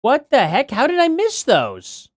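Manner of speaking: high, startled disbelief; shocked. Real, not acted. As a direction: gasp out the shock at missed messages. Missed super chats, shocked.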